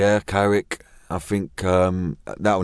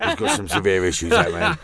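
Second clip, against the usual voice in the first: high, laughing through the words; real giggle pushing the voice up. Real, not acted. Laughing about Sean Bean's issues, high and giggling.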